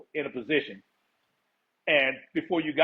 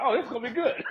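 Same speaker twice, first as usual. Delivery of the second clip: gleeful; 8 semitones higher, faster than usual. Retold or imitated. Retold delight at trusting commanders, then laughs.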